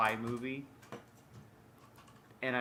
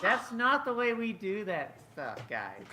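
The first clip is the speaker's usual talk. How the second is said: prim cleric scolding; 7 semitones higher, 3 dB louder than usual. Acted. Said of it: "I'm always like".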